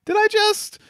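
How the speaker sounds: very high squeaky disbelief; hammed-up shocked squeak; mock stunned. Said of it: "and you're like".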